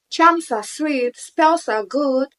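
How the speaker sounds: high, slow, loud declaration; slow loud proclaiming. Acted. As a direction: proclaim each word slowly. Preaching about charms and spells.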